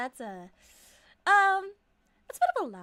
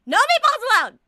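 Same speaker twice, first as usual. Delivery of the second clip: shouting; 9 semitones higher, 15 dB louder than usual. Acted. Fortune teller bans food, shouting.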